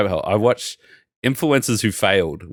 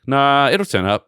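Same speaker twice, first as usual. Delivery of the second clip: mock dismissive seller; 7 semitones higher, 5 dB louder than usual. Seller brushing off lost parcel.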